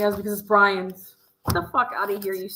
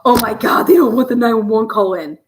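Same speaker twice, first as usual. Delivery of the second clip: mock outrage; 2 semitones higher, 8 dB louder than usual. Mocking media reaction.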